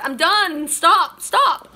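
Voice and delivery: a loud, rising cry to stop; mock giving up. Reader voicing the exhausted viewer who wants to quit, loudly. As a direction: shout it like tapping out on the mat. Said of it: "you were like tap out".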